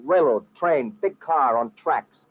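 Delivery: slow, spelled out for a foreigner; very slow patient explanation; slow explaining. Acted. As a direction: talk it out slowly and simply. Explaining slowly to Hassan.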